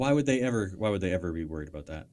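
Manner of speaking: mock-puzzled sarcasm; sarcastic. Real, not acted. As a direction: feign innocent bafflement, dripping with irony. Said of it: "that's, that's sarcasm".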